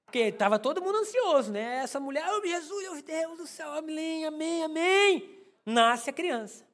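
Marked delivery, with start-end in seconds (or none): 2.3-5.3 praying wife. Retold or imitated. Wife praying during labor.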